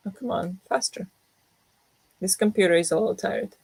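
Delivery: usual speaking voice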